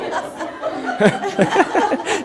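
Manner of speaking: an airy, high, unvoiced laugh at the slip; breathy laugh. Real, not acted. Laughing at himself after the name mix-up, an airy laugh.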